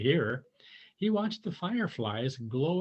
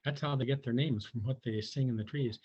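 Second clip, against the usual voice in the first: plain reading voice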